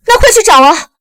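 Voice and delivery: frantic, pressing command; urgent outburst. Acted. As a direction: urge them to hurry and search. Mother learns children were kidnapped.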